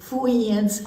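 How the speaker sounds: "Friends" as foo-WEE-ends; mispronounced friends. A narrator's reading. Storyteller showing Polly's word, "Friends" said as foo-WEE-ends. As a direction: say "Friends" with w for r, amused. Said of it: "She couldn't say friend either".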